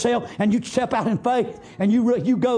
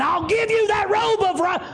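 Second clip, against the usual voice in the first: father's shouted promise; 12 semitones higher than usual. Acted.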